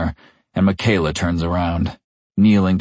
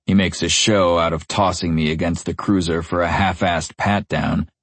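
plain reading voice

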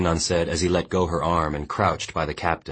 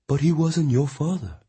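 higher, steady woman's voice; Mongnan consoling; 11 semitones higher than usual. A narrator's reading. Mongnan firmly consoling the weeping boy. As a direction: tell him firmly he died well.

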